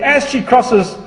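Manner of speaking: ordinary talk, nothing marked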